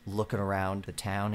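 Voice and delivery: ordinary delivery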